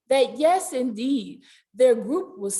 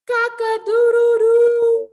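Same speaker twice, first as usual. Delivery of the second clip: high, loud crowing; big playful rooster call; 10 semitones higher, 8 dB louder than usual. Pretend. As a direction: crow like a rooster. Rooster crow imitation.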